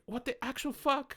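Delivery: high, slow disbelief; mock stunned outrage; incredulous. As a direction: stretch it out in disbelief. Incredulous retold reaction.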